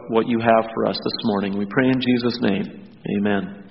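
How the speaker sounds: hushed, reverent prayer voice. Acted. Pastor leads prayer.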